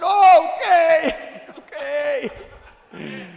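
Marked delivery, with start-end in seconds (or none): 0.0-2.3 high slow laughing okay; sheepish giving in. Retold or imitated. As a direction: back down with a meek laugh. Meek surrender to wife, laughing.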